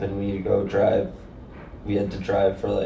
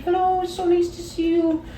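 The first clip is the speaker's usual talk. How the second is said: imitating a sweet dad in a much higher, squeaky voice; Sai's dad's high voice. Sai's sweet dad greeting her at the airport, in a much higher squeaky voice. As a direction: chirp it way up high like a kind old dad. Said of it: "like, the highest pitched voice".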